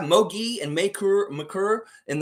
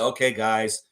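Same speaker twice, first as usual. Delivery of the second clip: lifeless locker room talk; 7 semitones lower than usual. Retold or imitated. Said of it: "He comes in the locker room and says".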